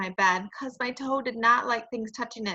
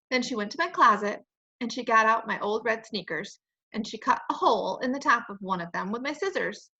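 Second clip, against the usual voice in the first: usual speaking voice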